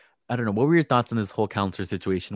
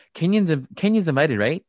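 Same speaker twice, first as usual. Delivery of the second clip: mock eager, higher and louder; 4 semitones higher, 4 dB louder than usual. Cynthia asking Lauren about Kenya, mock eager. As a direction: pitch it up like a fake-innocent friend.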